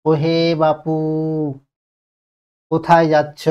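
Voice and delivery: calling out with বাপু stretched; ও হে বাপু called with a long বাপু; drawn-out call. Shown as a demonstration. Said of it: "এরকম আরো কিছু উদাহরণ হতে পারে".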